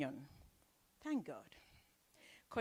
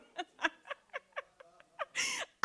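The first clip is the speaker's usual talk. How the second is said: high laugh. Real, not acted. Laughs at her own slip.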